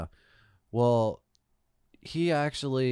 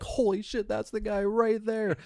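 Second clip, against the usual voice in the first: high, stretched-out amazed exclamation; astonished recognition; 10 semitones higher than usual. Retold or imitated. Storyteller replaying spotting the screamer in daytime, astonished recognition. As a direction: pitch it up in disbelief.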